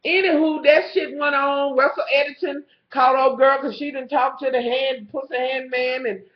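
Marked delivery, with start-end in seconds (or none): none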